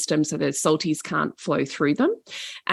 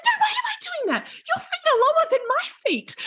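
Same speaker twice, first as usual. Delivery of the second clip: very high, laughing squeal; scared girl protesting to the old lady, very high squeal; indignant panic; faster than usual. Retold or imitated. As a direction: squeal back in outraged fright. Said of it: "And Jane's like".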